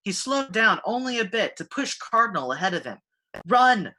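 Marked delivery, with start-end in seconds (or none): 3.5-4.0 loud urgent call to run; shouting. A narrator's reading. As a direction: shout toward the light. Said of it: "Riku shouted".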